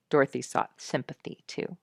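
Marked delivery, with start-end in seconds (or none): none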